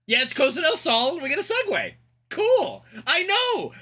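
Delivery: high-pitched, thrown reaction; caught off guard. Real, not acted. Gamer insisting he's not unhappy with it, high-pitched surprise. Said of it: "I just was not expecting it".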